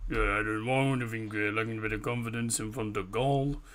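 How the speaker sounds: a lightly voiced Wenger; Arsene Wenger voice. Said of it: "there's Arsene Wenger".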